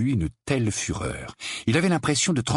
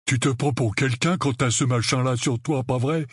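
higher, loutish challenge; resentful Dudley; 5 semitones higher than usual. Acted. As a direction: challenge your cousin sourly, voice raised in pitch. Dudley confronts Harry. (provocation hargneuse, voix plus aiguë)

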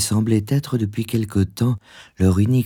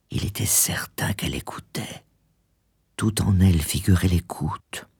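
near-whispered narration; soft, whispery storytelling; hushed suspense; 2 semitones lower, 6 dB quieter than usual. A narrator's reading. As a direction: drop to a breath as she listens. Narrator builds suspense as she listens.

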